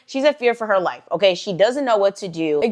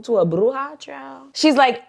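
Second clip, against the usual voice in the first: ordinary talk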